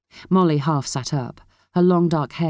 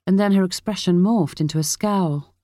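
usual speaking voice

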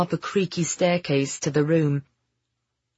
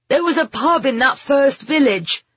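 raised, higher voice over the rain; shouting back; 8 semitones higher, 5 dB louder than usual. A narrator's reading. Ben calls back to his companion in the rain, shouting. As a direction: shout your answer through the downpour. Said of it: "Ben shouted back".

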